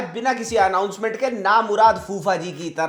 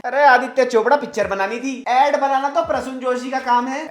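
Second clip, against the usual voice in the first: ordinary talk, nothing marked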